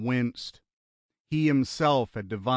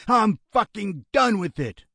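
high, loud outburst; angry shout; 9 semitones higher, 5 dB louder than usual. A narrator's reading. Gunman refusing to go on, angry shout. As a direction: explode in exasperation. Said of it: "Lionel shouted".

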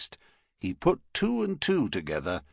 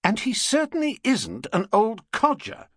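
much higher, cutting-in woman's voice; indignant Mrs Brown; 13 semitones higher, 4 dB louder than usual. Acted. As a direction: interrupt like a protective mother. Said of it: "broke in Missus Brown".

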